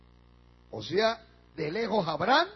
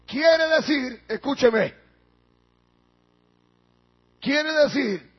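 ordinary talk

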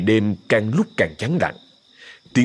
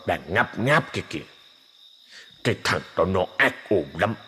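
grumbling ghost; 4 dB quieter than usual. Acted. Old ghost grumbles about his betrayer, softer ghostly voice. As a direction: mutter like a bitter ghost.

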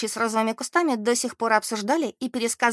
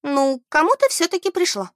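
raised-pitch, shrugging comeback; offhand retort; 7 semitones higher, 4 dB louder than usual. A narrator's reading. Beth answers her indignant friend Mary, shrugging it off. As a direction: toss it back with a verbal shrug. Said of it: "пожала плечами Бет".

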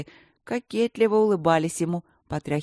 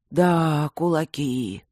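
sighing; 4 dB louder, much slower than usual. Acted. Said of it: "вздохнул второй Камен".

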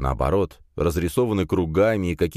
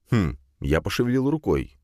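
ordinary delivery, nothing marked

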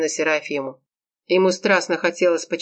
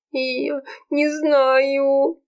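long-drawn, high-pitched moan; moaning wail; 8 semitones higher, much slower than usual. A narrator's reading. Serafima found crying alone on the beach, moaning. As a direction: drag it out in a high, miserable whine. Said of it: "простонала Серафима".

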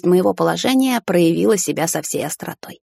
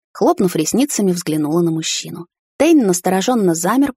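usual speaking voice

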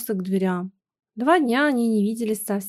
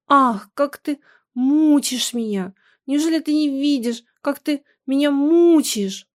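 louder, higher, pleading accusation; anguished reproach; 5 semitones higher, 5 dB louder than usual. A narrator's reading. Wife tells husband he is tormenting her, anguished reproach. Tearful reproach in acted quarrel.

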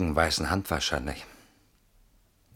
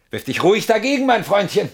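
loud, high, mocking dare; taunting shout; 9 dB louder than usual. Man behind a locked door taunts the intruder with a loud, high mocking shout. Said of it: "Das ist der richtige Ton".

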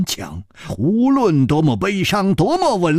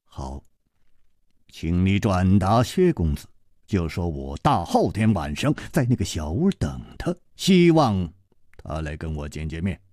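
put-on calm older hero; 9 semitones lower, 3 dB quieter than usual. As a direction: speak low and unhurried, gently reproaching. Chu Liuxiang gives message.

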